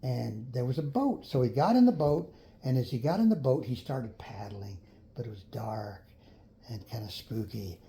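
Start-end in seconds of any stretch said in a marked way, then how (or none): 3.6-7.8 much softer spooky storytelling; spooky hush. A narrator's reading. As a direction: drop to a hush, dark and spooky. Dark spooky cave narration.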